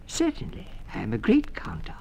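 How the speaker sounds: very high mouse voice on Certainly, then a breathy whisper on the rest; squeaky teacher mouse. A narrator's reading. Teacher mouse proudly accepting the pupils, very high squeak. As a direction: squeak it out like a tiny proud schoolmistress.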